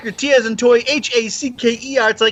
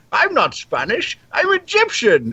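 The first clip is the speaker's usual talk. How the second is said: Sean Connery impression; 5 semitones higher, slower than usual.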